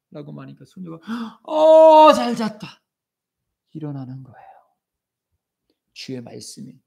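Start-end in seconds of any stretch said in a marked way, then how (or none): 1.4-2.8 put-on waking girl. Girl wakes up.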